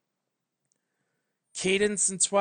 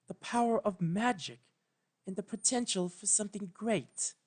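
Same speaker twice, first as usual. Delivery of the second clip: higher, soft regal voice; higher, softer voice of a careful royal mentor; careful Celestia; 6 semitones higher, 4 dB quieter than usual. A narrator's reading. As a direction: pick each word with care, gently. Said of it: "She chose her words carefully".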